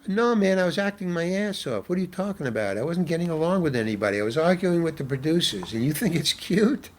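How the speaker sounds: ordinary talk, nothing marked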